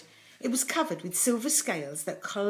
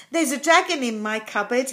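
louder, higher, eager announcement; 5 semitones higher, 8 dB louder than usual. A narrator's reading. Jonathan answers the postwoman's greeting, eagerly. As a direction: blurt out the big news eagerly. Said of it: "he answered eagerly".

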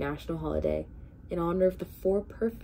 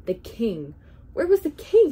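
rising to a sudden high, loud cry; alarmed gasp; 5 semitones higher, 9 dB louder than usual. A narrator's reading. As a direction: blurt it out in alarm, much higher and louder. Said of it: "Bartholomew gasped".

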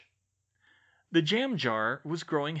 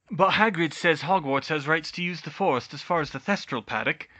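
raised, insistent objection; Hermione, protesting; 3 semitones higher, 6 dB louder than usual. Acted. Hermione cites Hagrid's word to Bane, protesting. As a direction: argue your case earnestly.

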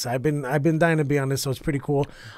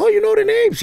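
put-on very high-pitched kid voice; imitated daughter. Daughter questions dad about Full House, imitated high voice.

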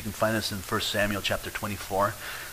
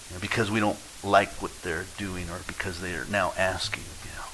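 hushed, breathy aside to the congregation; whisper; 4 semitones lower, 5 dB quieter than usual. Real, not acted. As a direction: drop to a confiding whisper. Sermon aside dropped to whisper.

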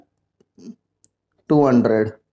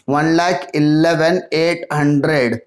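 slow, drawn-out repeat of the total; slow dictation; slower than usual. Real, not acted. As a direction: read the number out slowly for note-takers. Repeats total slowly for writing down.

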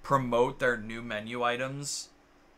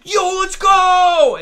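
excited shout; 18 dB louder, much slower than usual. Retold or imitated. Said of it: "And I went".

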